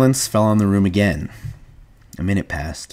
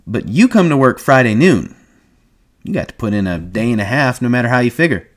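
lively, louder, higher ranch hand; knowing ranch hand; 5 semitones higher, 5 dB louder than usual. Acted. Whit telling George how big ranches work, animated. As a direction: explain it with animated, know-it-all ease.

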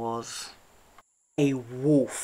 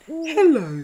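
high, loud hooted hello; hooting owl; 13 semitones higher, 11 dB louder than usual. A narrator's reading. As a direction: owl calling out a greeting. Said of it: "hooted Owl".

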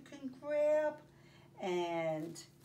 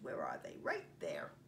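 whispered aside; 3 dB quieter than usual. Real, not acted. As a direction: hush your voice to point them out. Pointing out mittens on page.

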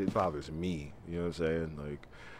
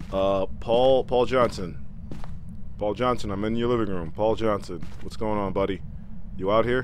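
loud, higher calls; calling out; 3 semitones higher, 5 dB louder than usual. Real, not acted. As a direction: call through the living room. Calling ghost loudly.

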